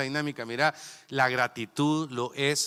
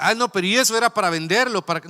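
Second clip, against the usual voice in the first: quick, louder, higher skeptical voice; mock objection; 5 semitones higher, 5 dB louder, faster than usual. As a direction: play the doubter questioning the giveaway. Retold objection from a listener. (objeción burlona, rápida y aguda)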